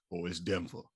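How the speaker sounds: low, very soft African-Southern imitation; hushed mock African Southern accent; weird African Southern accent. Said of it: "In his weird African Southern accent".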